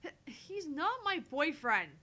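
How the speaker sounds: stammer, then loud, high, slow protest; forceful denial. Acted. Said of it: "came out with more force".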